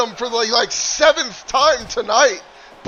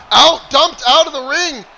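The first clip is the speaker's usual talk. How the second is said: excited call; 5 semitones higher, 7 dB louder than usual. Real, not acted. Wrestler thrown from ring.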